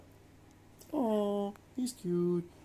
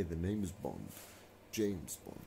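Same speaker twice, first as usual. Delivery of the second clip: serious Bond line; 8 semitones lower, 9 dB quieter than usual. Shown as a demonstration. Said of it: "say it all".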